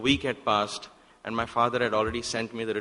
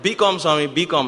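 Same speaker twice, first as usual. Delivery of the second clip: loud call, pitched higher; urgent call; 5 semitones higher, 8 dB louder than usual. Retold or imitated. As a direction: call out urgently to get Swami's attention. Urgently calling out to Swami.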